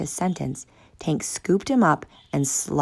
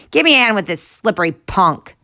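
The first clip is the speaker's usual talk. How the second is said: loud call; 5 semitones higher, 7 dB louder than usual. Acted. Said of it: "called out".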